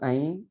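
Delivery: ऐं as a nasal glide without M or N; nasalised vowel. Shown as a demonstration. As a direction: let ऐं finish in the nose. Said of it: "नाक पे, नाक के". (ऐं, अनुस्वार में नाक से)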